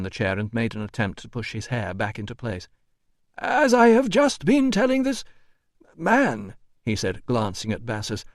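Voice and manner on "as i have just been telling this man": high, fussy official voice; indignant administrator. A narrator's reading. Administrator complaining about the doorman, high and indignant.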